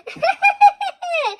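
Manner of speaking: shrill gleeful ghost laugh; mocking high laugh at the rival ghost; high ghost cackle. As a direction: cackle high like a happy spirit.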